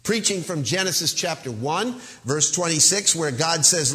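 ordinary talk

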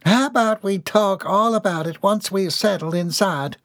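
high, nervous country woman; anxious. A narrator's reading. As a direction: put the question off anxiously. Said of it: "wrung her hands anxiously".

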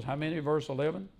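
ordinary talk

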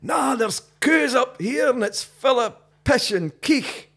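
very high, drawn-out Highland call; hammed-up Highlander, slow and high-pitched; Highlander shouting down. Old Highlander calling downstream to English camper, shouting down. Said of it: "guy shouts down".

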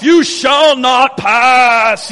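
booming slow wizard proclamation; Gandalf. Retold or imitated. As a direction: hold back the storm with a wizard's command. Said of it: "Like, like Gandalf".

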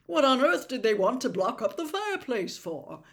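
put-on voice of a puzzled man; baffled father. A narrator's reading. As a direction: ask it in loud bewilderment. Baffled man shouts from inside fireplace.

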